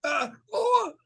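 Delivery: very high, broken cry and gag; hysterical gagging. Retold or imitated. Kid faking a dying gasp to scare off bullies, hysterical cry. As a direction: wail as if the ribs punctured a lung. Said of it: "this hysterical gagging".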